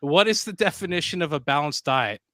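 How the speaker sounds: normal talking voice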